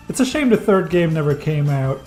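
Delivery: ordinary talk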